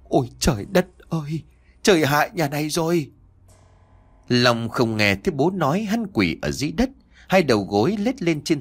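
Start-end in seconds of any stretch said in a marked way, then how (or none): 0.0-3.1 sighing lament to the sky; father lamenting ruin looking skyward; despairing sigh. Acted. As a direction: let it out in a long sigh. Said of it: "cụ thở dài thành tiếng".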